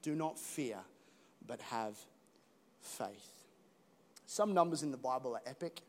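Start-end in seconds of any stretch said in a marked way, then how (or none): 0.0-3.4 soft, slow, reassuring; Jesus gently reassuring the people, softer; gentle Jesus. Retold or imitated. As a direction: invite them in tenderly. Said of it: "Jesus ... says".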